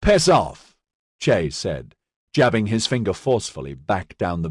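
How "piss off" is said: loud, higher snapped order; curt angry dismissal. A narrator's reading. Sergeant to the gang leader, loud and angry. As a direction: tell him to get lost.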